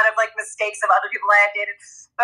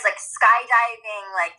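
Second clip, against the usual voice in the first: ordinary talk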